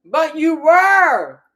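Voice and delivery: slow, loud, drawn-out "were" pressed hard; emphatic accusation. Real, not acted. Host calling out a guest, slow and emphatic. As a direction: lean hard on "were" to call her out.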